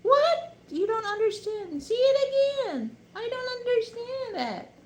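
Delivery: high, mock baffled American listener; American who can't follow the accent; confused American. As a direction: ham up a baffled American asking for a repeat. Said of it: "if you're American".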